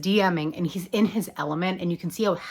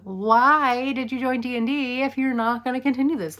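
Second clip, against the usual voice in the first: higher, louder put-out protest; slighted; 7 semitones higher, 5 dB louder than usual. Acted. Eddie complaining that Lucas is skipping the campaign, slighted. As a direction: act out a slighted, indignant complaint. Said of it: "feeling a little bit slighted by that".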